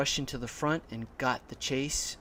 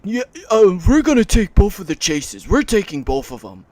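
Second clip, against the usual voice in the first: loud, higher mock voice; mocking rival customer; 6 semitones higher, 15 dB louder than usual. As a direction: imitate a pushy customer at top volume. Mimics rival customer arguing.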